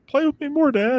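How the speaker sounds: put-on high, pleading kid voice; imitating little son. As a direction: beg like a six-year-old boy. Son's plea voiced in story.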